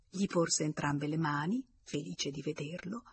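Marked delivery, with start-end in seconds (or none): none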